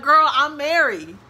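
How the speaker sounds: loud, high, slow sassy retort; playful sassy clapback; sassy comeback. Retold or imitated. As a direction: fire back with attitude. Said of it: "I was gonna be like".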